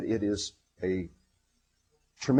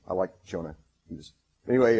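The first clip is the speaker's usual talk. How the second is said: usual speaking voice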